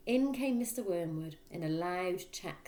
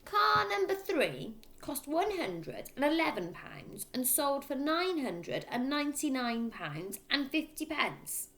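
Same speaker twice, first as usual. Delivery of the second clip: dictating father; 9 semitones higher, slower than usual. A narrator's reading. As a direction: spell out each figure like an order.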